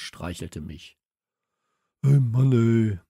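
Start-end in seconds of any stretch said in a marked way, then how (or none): 2.0-3.0 loud exasperated groan of words; acted loud exasperation. A narrator's reading. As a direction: exclaim it loudly in frustration. Friedhelm's exasperated exclamation, acted.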